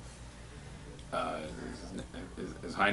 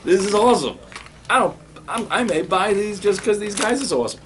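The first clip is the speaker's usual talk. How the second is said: much higher and louder, bursting with delight; thrilled gushing; 11 semitones higher, 4 dB louder than usual. Real, not acted. Showing the goblin figures to camera, high excited squeal. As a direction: squeal over the new toys with a laugh.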